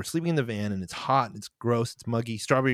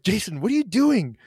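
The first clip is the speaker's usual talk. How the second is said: harsh snapping; 8 semitones higher, 4 dB louder than usual. Retold or imitated. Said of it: "I didn't say it like that".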